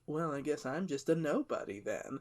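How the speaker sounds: quietly sassy, hurt pride. Retold or imitated. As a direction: act hurt with a touch of sass. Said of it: "they're, like, sassy about it".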